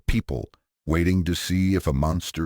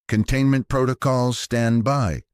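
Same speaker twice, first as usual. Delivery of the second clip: commanding; 8 semitones higher than usual. A narrator's reading. Valdez giving orders on bridge.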